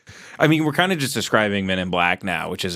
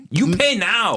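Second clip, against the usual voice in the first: mock Vietnamese accent; 9 semitones higher, slower than usual. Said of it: "friend joins accent bit".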